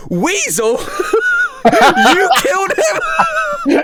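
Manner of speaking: high, loud, giggling mock shout of the crowd; laughing cheer. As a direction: cheer his name through the laughter, way up high. Crowd cheering and pointing at him.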